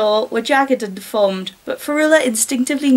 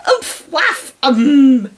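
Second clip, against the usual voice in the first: muffled mumble; 4 dB louder, much slower than usual. A narrator's reading. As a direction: mumble with lips pressed shut. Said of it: "the girl mumbled".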